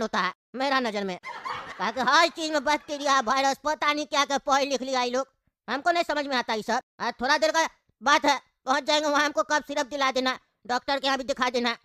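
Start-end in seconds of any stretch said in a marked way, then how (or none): none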